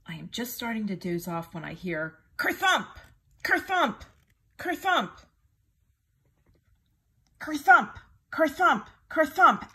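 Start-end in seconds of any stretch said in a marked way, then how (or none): 2.3-9.7 much higher, louder thump words; thumping sound effect. A narrator's reading. Narrator sounding the tiger crashing, loud and higher. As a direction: slam each kerthump down.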